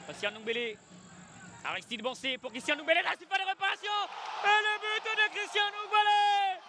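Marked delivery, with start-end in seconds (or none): 4.4-6.6 excited goal call. Real, not acted.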